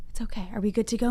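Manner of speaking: usual speaking voice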